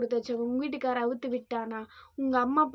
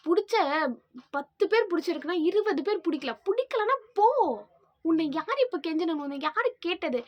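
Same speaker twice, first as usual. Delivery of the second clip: loud and higher, fuming; shouting back; 4 semitones higher, 4 dB louder than usual. Real, not acted. Young woman yelling at commenters, loud and higher. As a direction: yell it out, done with them.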